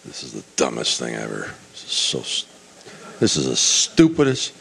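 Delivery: acted disgruntled grumble, low and under the breath at first; bad-mood grumbling. Naaman wading reluctantly into the Jordan, grumbling in a bad mood. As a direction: play a man grumbling to himself. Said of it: "he's in a bad mood".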